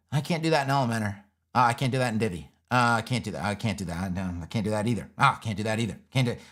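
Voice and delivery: low, droning imitation of users giving up; mock discouraged user. Voicing frustrated users trying other builders.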